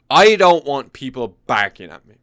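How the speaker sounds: emphatic. Real, not acted. To the viewers, loudly refusing a rowdy wedding. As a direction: hit "I don't want people barking at me" hard. Repeats the line louder.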